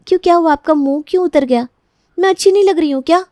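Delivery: high, quick, sugary mock concern; mock-innocent teasing. Younger bride teasing her jealous sister-in-law, mock-innocent. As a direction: needle her with fake innocence.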